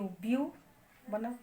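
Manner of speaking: ordinary delivery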